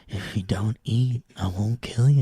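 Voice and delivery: lightly voiced slasher threat; killer voice. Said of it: "go like".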